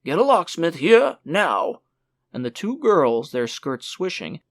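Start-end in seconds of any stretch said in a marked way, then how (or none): 0.0-1.8 loud, higher barked command; urgent order. Acted. Father ordering the maids to fetch a locksmith, shouting. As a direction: demand it at once.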